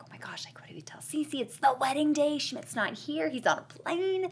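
whispery retold panic, voice climbing; imitating panicked characters. Friends in the bathroom wondering what to tell bride, hushed mock panic. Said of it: "and they're like".

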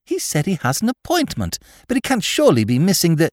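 high-pitched, puzzled protest; baffled surprise. A narrator's reading. Astonished Miss Tarrant puzzles over his exit.

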